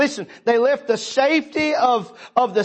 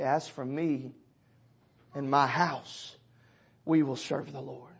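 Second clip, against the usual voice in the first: softer, lower preaching; hushed; 7 semitones lower, 9 dB quieter than usual. Acted. Preacher softly calling the church to serve the Lord, hushed. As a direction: drop to an intimate near-hush. Soft turn to the call.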